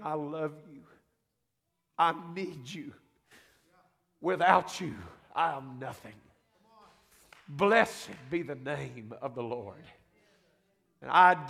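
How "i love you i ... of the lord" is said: retold fervent prayer. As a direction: lightly voice an old man pouring his heart to God. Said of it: "He'd get to praying".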